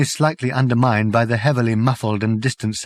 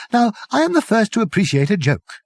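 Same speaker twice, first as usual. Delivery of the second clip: lightly voiced Arthur; 11 semitones higher, faster than usual. A narrator's reading.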